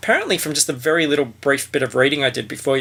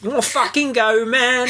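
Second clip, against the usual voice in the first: high, laughing Cockney challenge; a hammed-up Cockney accent, daring them to fight; 9 semitones higher than usual. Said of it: "Is that your Cockney accent?".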